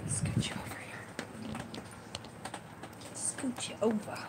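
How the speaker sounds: soft unvoiced whisper. Real, not acted. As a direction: whisper while rummaging. Whispering while digging.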